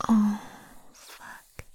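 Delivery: ordinary talk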